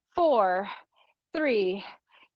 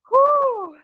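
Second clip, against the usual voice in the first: high, loud whoop of encouragement; cheering whoop; 13 semitones higher, 5 dB louder than usual. Real, not acted. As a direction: whoop to fire up the class. Cheering class after the set.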